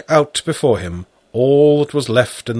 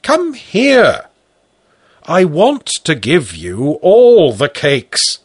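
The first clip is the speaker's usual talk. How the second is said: loud, high, slower coaxing; coaxing call; 6 semitones higher, 6 dB louder, slower than usual. Acted. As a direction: coax the boy out. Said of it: "I repeated".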